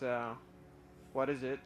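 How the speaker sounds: ordinary delivery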